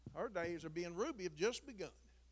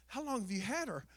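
lightly voiced lady, a higher pitch; imitating a lady; 4 semitones higher than usual. Lady at the vet's asks about the dog, lightly imitated. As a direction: raise it slightly for the lady's question. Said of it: "the lady that was out there".

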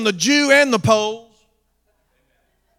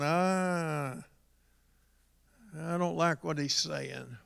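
low, very soft mock grumble; disapproving grumble; 4 semitones lower, 13 dB quieter, slower than usual. Scribes sitting listening to Jesus, low disapproving grumble. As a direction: voice a scribe's quiet disapproval under the breath.

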